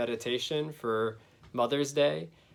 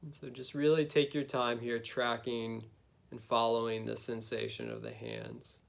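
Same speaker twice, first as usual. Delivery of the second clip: guided meditation. Acted. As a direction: gently lead them inward, unhurried.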